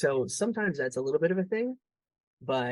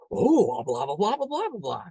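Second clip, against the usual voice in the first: mocking blabber; 6 semitones higher, 4 dB louder than usual. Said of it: "he's like".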